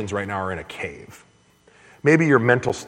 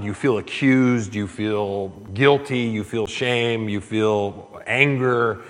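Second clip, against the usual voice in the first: slow, deliberate list of feelings; slow litany; slower than usual. Acted. Preacher naming the listeners' hurts one by one, slowly. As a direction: let each feeling land slowly.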